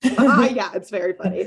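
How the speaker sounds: ordinary delivery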